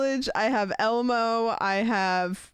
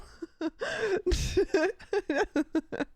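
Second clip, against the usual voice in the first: big laugh; 8 semitones higher than usual. Real, not acted. Laughing at the clip.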